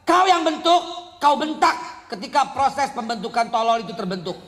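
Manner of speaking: loud, high rebuke from the pulpit; preacher scolding parents, loud and rising; fiery preaching. Acted. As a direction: hammer the point home at full force.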